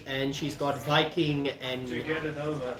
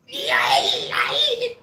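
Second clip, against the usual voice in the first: strained laugh; 4 dB louder than usual. Shown as a demonstration. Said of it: "That hurts my throat a bit".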